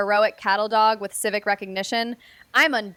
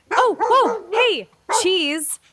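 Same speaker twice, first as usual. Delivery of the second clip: high, bright-pitched call to the dog, surprised; talking to dog; 6 semitones higher, 3 dB louder, slower than usual. Acted. Owner surprised by her dog, higher dog-talk voice. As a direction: coo at the dog as it wanders off.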